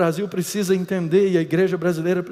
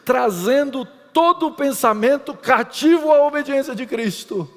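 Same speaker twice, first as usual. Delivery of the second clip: raised, higher preaching voice; 6 semitones higher, 6 dB louder than usual. Acted. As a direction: proclaim it to the congregation. Pregação em alta voz. (pregação fervorosa, voz mais alta)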